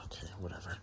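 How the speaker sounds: muttered under the breath; whispered aside. Real, not acted. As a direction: whisper it to yourself.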